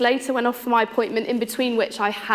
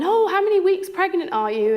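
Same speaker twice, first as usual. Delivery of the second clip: cheerful chirpy question; 10 semitones higher than usual. Shown as a demonstration. Clinic staff cheerfully asking a pregnant patient, high and bright. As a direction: chirp it like happy news. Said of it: "rather than".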